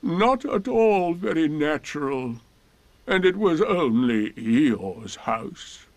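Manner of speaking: most melancholy voice. Acted. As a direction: drag it out with heavy self-pity. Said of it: "said Eeyore in his most melancholy voice".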